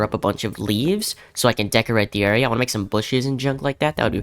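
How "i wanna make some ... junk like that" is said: ordinary talk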